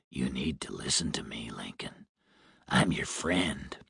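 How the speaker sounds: low hoarse smoker's whisper. A narrator's reading. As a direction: threaten in a rasping whisper. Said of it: "The man sounded hoarse, like a heavy smoker".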